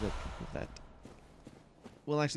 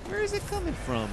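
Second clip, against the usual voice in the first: high squeaky voice; 4 dB louder than usual. Retold or imitated. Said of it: "I'm like".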